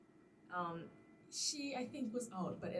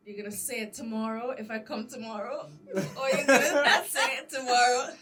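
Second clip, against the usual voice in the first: laughing challenge; 6 semitones higher, 13 dB louder than usual. Retold or imitated. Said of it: "So I said".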